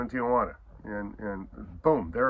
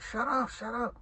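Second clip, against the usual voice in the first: much higher hurried hush; mock hushing; 12 semitones higher than usual. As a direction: shush a rowdy friend. Telling friend to stop barking.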